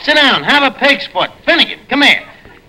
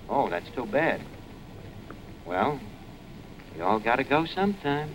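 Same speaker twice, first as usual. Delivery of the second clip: much softer, low murmur; hushed sympathy; 5 semitones lower, 16 dB quieter than usual. Acted. Archie hearing bad news about Zoe on the phone, hushed. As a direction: drop to a hushed condolence.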